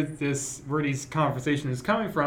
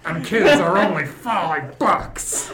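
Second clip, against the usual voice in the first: monster truck ad; 4 dB louder, slower than usual. Pretend. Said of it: "hyping up a monster truck rally".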